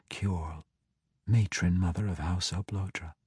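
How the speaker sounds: soft, hushed breathy whisper. A narrator's reading. Ivanel whispers to the prisoner over the scrying pool. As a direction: hush it as if not to break the spell.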